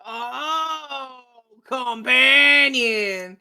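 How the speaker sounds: drawn-out, loud mock echo; teasing. Real, not acted. Game master teases a player over "companion", teasing. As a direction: stretch it out teasingly.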